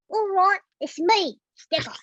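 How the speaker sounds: put-on gap-toothed street-kid voice, high and loud; Sticker. Showing friends the imagined character, cheeky urchin voice. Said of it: "this character Sticker".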